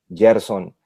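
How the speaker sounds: "[name]" as "YER-son", opening with a Y sound; Y pronunciation. Shown as a demonstration. Guest listing variants of his name, "[name]" as "Yerson". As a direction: say "[name]" with a Y at the start. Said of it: "personas que lo pronuncian".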